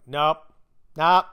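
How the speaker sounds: loud, abrupt mock refusal; firm refusal. Cops turning people away at a roadblock, firm refusal.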